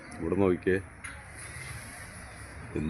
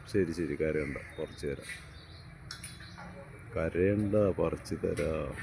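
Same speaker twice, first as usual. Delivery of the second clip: hushing; 3 semitones lower, 10 dB quieter, slower than usual. Acted. Hushing crying toddler.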